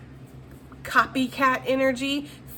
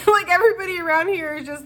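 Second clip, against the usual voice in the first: high, loud laughing complaint; laughing scorn; 9 semitones higher, 6 dB louder, faster than usual. Retold or imitated. Reader mocking useless coworkers, laughing. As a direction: laugh it off in disbelief.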